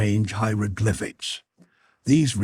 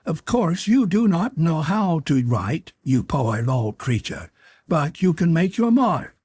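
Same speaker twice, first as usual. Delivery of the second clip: higher, lofty voice of the condescending creature; supercilious creature; 8 semitones higher than usual. A narrator's reading. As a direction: talk down to him from above. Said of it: "as much as to say".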